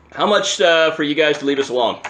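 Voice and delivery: high, loud call to the whole group; yelled offer. Acted. Player's character yells to the attackers to bargain, loud yell. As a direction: yell it out over a brawl. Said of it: "You yell out".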